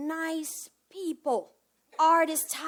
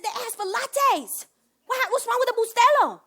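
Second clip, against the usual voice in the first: indignant friend; 9 semitones higher, 8 dB louder than usual. Retold or imitated. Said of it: "she said".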